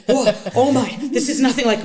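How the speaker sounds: loud, high retold amazement; re-enacted awestruck exclamation; amazed outburst. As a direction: blurt it out, blown away, half laughing. Retelling her amazed first bite.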